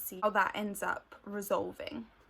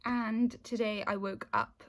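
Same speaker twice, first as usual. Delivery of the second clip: ordinary delivery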